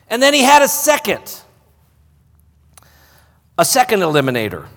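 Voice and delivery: loud, high preaching voice; full-force preaching. Acted. Pastor preaching Gideon's story to the church, loud and high. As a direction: preach it out to the congregation.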